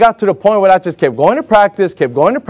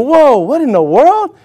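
high, slow, acted disbelief; stretched-out mock astonishment; baffled; 8 semitones higher than usual. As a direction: react as if robbed of an award. Acts out his baffled thought.

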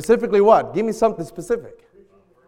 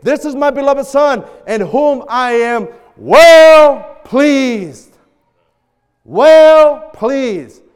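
loud, higher, drawn-out proclamation; booming scriptural declaration; voice from heaven; 5 semitones higher, 9 dB louder, much slower than usual. Acted. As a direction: proclaim it from heaven, stretching the key words. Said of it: "a voice from heaven saying".

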